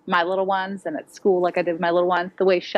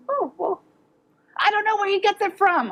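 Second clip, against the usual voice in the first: mock innocent; 11 semitones higher than usual.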